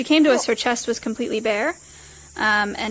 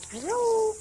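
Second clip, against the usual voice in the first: high sing-song hello; 10 semitones higher than usual. Real, not acted. Greeting the parrot.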